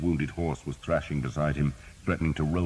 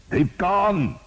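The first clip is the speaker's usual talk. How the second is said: high, loud call; shouting; 7 dB louder than usual. A narrator's reading. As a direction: make him hear it. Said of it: "Thomas shouted".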